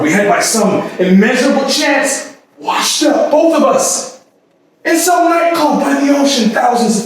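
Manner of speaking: rising high and swelling into a shout; building to a cry. Acted. Woman telling her lover how they washed up by the sea, rising to a shout. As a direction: let it climb until it bursts out. Swept up recalling the night by the sea.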